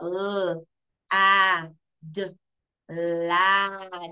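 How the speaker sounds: put-on teacher sounding out lad for kids. Shown as a demonstration. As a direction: sound out L, A, D, then blend into lad. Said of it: "let's sound it out".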